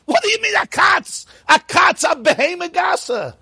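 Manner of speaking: high-pitched, incredulous objection; indignant disbelief. Acted. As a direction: protest it as if outraged, voice shooting up. Acting incredulous objection to the comparison.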